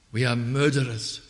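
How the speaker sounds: ordinary talk, nothing marked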